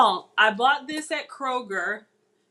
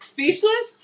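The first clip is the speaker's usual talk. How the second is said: surprised; 11 semitones higher, 6 dB louder than usual. Real, not acted. Echoes husband's one-word verdict.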